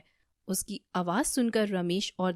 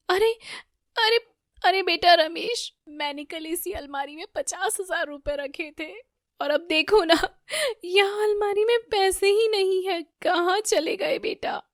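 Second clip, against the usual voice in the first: high, tearful mother's wail; 10 semitones higher, 4 dB louder than usual. A narrator's reading. Neelam tells her son the money is gone, wailing. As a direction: wail in distress, much higher. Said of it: "नीलम जोर जोर से चिल्लाने लगती है".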